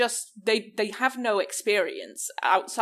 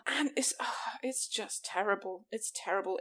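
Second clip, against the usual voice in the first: softer, breathy murmur of disgust; hushed disgust; 10 dB quieter than usual. Real, not acted. Friend mutters her disgust with the film almost in a whisper. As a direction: drop to a hushed groan.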